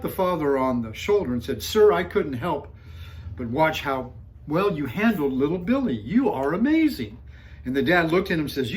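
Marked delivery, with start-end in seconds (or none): 1.6-7.2 loud, higher-pitched gushing compliment, acted out; admiring stranger. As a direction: gush at him with big emphatic praise. Admiring shopper compliments the father.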